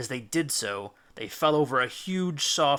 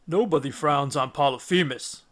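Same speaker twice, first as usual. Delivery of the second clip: giant's voice. Acted. Cyclops boasting.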